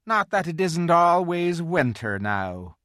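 loud, high gloomy lament; drawn-out gloomy complaint. A narrator's reading. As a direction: moan it gloomily, lifting the voice. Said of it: "he added gloomily".

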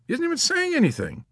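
high, quick protest; protesting bystander. A narrator's reading. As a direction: object in a high, hurried voice. Kid Sampson protests the shushing.

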